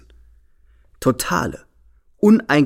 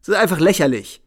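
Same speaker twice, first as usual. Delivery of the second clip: laughing scorn; 5 semitones higher, 4 dB louder than usual. A narrator's reading.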